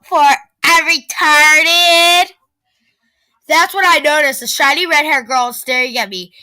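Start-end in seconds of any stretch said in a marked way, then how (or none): 0.0-2.3 slow, louder sneering voice; mocking bully. A narrator's reading. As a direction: drag out the insult.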